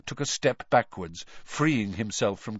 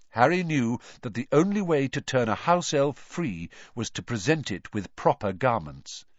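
usual speaking voice

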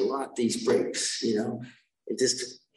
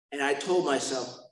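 normal talking voice

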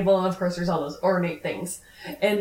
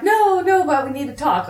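urgent protest; 7 semitones higher, 5 dB louder than usual. Retold or imitated. Danielle urgently pleading with prince.

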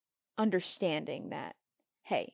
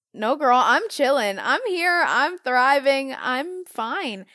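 breezy reassurance; 5 semitones higher, 9 dB louder, slower than usual. Retold or imitated. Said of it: "And I'm like".

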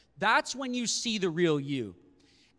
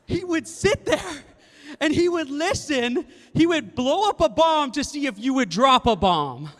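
dramatic build; 7 semitones higher, 8 dB louder than usual. Real, not acted. Dramatic build about the prank.

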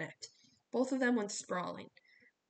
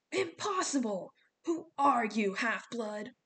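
loud, raised outraged cry; Dylan's outraged wail; 2 semitones higher, 5 dB louder, slower than usual. A narrator's reading. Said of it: "Dylan wailed in outrage".

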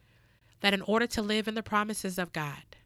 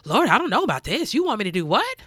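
retold, half-panicked plea to God, rising louder; flustered prayer; 3 semitones higher, 6 dB louder, faster than usual. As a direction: stammer it out like someone overwhelmed by God's ask. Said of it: "I was like".

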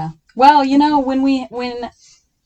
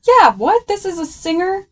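high, loud disbelief; surprised; 9 semitones higher, 6 dB louder than usual. Real, not acted. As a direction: burst out in disbelief. Surprised at the singer detail.

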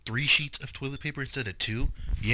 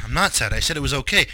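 ordinary talk, nothing marked

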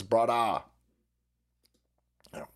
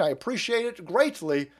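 normal talking voice

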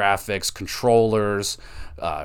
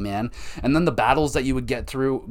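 normal talking voice

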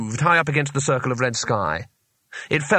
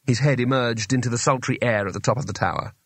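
usual speaking voice

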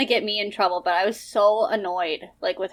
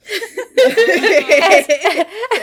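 loud laugh; 8 semitones higher, 8 dB louder than usual. Real, not acted. Laughing at choking cohost.

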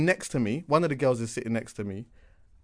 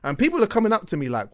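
normal talking voice

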